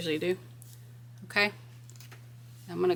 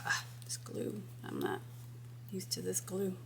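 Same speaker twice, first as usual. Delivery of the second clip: muttering to self; 16 dB quieter than usual. Real, not acted. Muttered aside about the glue.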